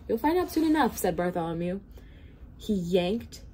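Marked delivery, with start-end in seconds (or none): 0.1-1.0 put-on boy voice. Bartholomew voiced by narrator.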